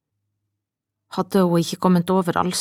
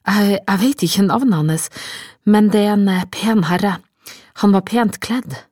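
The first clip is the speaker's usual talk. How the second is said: halting, louder stammered answer; flustered, confused; 3 semitones higher, 5 dB louder than usual. A narrator's reading. Confused woman describes a well-dressed guest to the innkeeper, flustered. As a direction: grope for details, stumbling and raised in voice. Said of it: "Rebekka så forvirra på han".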